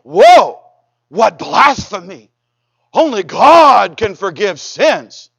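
slow, drawn-out mock outrage; scoffing. Pharisees scoffing at Jesus forgiving sins, mocking. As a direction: draw out the words in scandalized scorn. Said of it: "scoff and mock and say".

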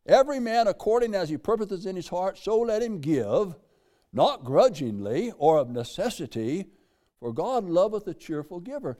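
lifted pulpit reading of scripture. Acted. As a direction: read it out like holy writ. Reads scripture aloud.

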